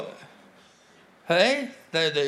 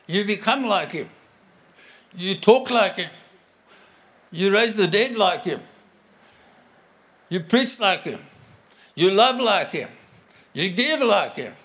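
louder, driving preacher's refrain; preaching at full force; 3 semitones higher, 4 dB louder than usual. Acted. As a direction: hammer each "like him" home to the congregation. Preaching litany, building intensity.

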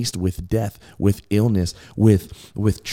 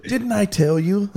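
much higher, squeaky mock Jesus voice; playful Jesus quote; 13 semitones higher than usual. Echoing Jesus's words to Martha in a high teasing squeak. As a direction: pitch it way up, teasing and comic. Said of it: "And he says that to Martha, too".

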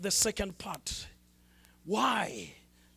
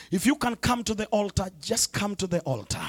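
ordinary delivery

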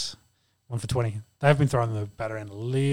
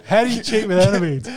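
incredulous; 5 semitones higher, 3 dB louder than usual. Real, not acted. Amazed question at story.

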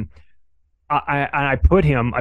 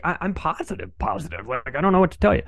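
retold mild exasperation, pitched up; mildly annoyed; 4 semitones higher, 3 dB quieter than usual. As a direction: imitate a man getting annoyed at being asked. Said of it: "he's getting kind of annoyed".

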